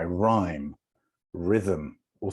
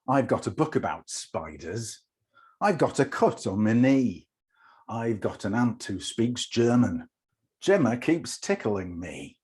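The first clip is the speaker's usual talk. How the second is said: put-on performed children's poem; 3 semitones higher than usual. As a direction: recite it as pupils quizzing a visiting writer. Reads the poem aloud.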